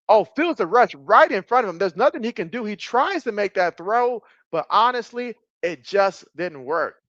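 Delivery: high-pitched, excited game call; animated sportscaster, easing down at the end; live play-by-play. Real, not acted. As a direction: call the rushed throw as it unfolds. Live play-by-play of rushed throw.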